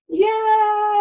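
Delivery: high bright cheer; joyful yay. Acted. Cheer after chant.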